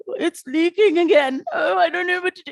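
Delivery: mock the helpless patient with a leaking colostomy bag; whiny patient. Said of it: "And it's like".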